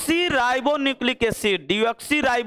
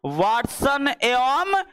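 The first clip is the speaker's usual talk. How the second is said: slow dictation; much slower than usual. Shown as a demonstration. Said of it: "ये DNA का design किसने दिया था?".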